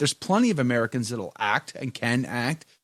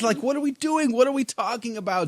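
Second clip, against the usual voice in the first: high-pitched comic protest; playful exasperated outburst, pitched up; mock exasperation; 6 semitones higher than usual. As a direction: plead in hammed-up disbelief, stretching the end. Mock exasperation at actor's missing accent.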